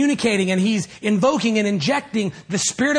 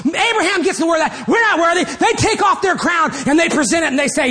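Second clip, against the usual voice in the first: ordinary talk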